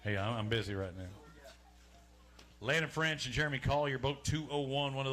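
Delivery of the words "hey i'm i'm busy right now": low-pitched mock phone voice; mock phone answer to Mama. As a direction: pretend to brush off a caller in a lower voice. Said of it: "He's like".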